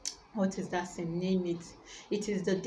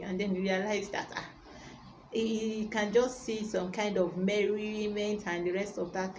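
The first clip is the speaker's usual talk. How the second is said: ordinary talk, nothing marked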